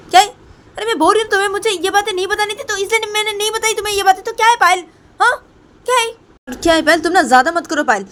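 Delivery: very high, loud, heated retort; angry shouting. Acted. Poonam tells Payal to stop her anger, shouting. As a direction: snap back at full pitch and volume.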